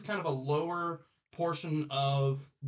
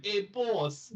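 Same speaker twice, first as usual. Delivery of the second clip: Italian-tinged kobold; 5 semitones higher than usual. Acted. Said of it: "I almost went Italian".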